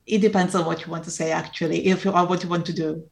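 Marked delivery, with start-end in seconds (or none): none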